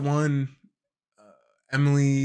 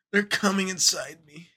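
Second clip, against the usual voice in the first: put-on whiny emo teen; 6 semitones higher than usual. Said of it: "emo kid trying to be quirky".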